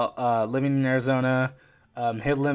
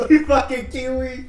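high, loud, slow, through laughter; laughing tease; 11 semitones higher, 8 dB louder than usual. Real, not acted. Host teasing guest with a mock insult, laughing. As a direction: draw out the jibe while cracking up.

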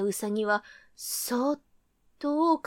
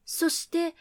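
ordinary delivery

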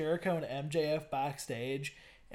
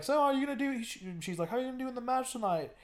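mock interviewer; 8 semitones higher, much faster than usual. Imitating the interviewer's question.

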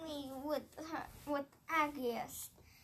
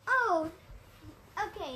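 normal talking voice

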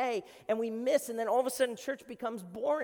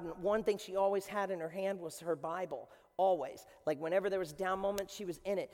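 ordinary talk, nothing marked

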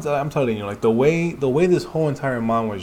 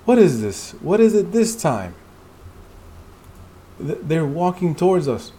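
higher, louder alarm; alarmed character; 7 semitones higher, 3 dB louder than usual. Acted. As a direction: sound alarmed at the threat.

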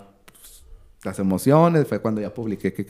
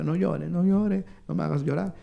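tearful plea; 6 semitones higher, 5 dB quieter than usual. Retold or imitated. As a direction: beg him to stop crying. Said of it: "ya me abrazó Claudia".

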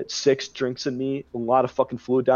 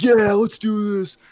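higher, slow hammed-up party-bro hype; mock frat bro; 8 semitones higher, 4 dB louder, slower than usual. Newcomer trying to act frat at the party, hammed up. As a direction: play the try-hard frat guy. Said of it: "trying so hard to be fucking frat".

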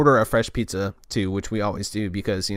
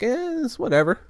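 dismissive; 13 semitones higher than usual. Retold or imitated. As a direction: shrug it off in a raised pitch. Shrugs off the TV fiddling.

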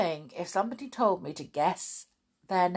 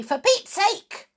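high, playful outburst; mock exclamation; 4 dB louder than usual. Shown as a demonstration. Presenter showing the phrase to say, exclaiming. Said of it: "and say".

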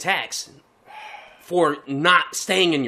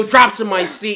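ordinary talk, nothing marked

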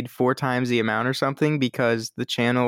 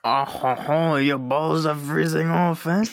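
mock French cartoon accent; playful fake-French skunk voice; Pepe Le Pew; 3 semitones higher than usual. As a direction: tease in a hammy French accent, drawn out. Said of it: "Shouldn't be Pepe Le Pew then".

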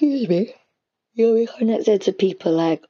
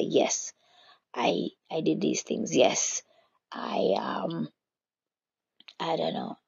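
breathy whisper, under the breath; a hushed, self-satisfied whisper; whispered boast; 5 dB quieter, slower than usual. Pretend. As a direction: murmur the pride like a secret to yourself. Prideful believer boasting to himself.

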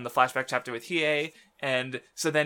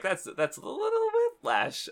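mock reaction; 14 semitones higher than usual. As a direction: pitch it way up in a small voice. Quoting own reaction while reading.

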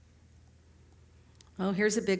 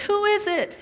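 high, loud shouted question; calling up; 11 semitones higher, 7 dB louder than usual. Acted. Man on the cliff calls up to the voice, shouting. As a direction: call it out to an unseen voice above.

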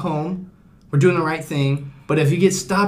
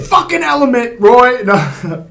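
loud joking shout through laughter; laughing shout; 7 semitones higher, 6 dB louder, slower than usual. Pretend. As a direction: yell it at a friend, cracking up. Mock scolding friend, laughing.

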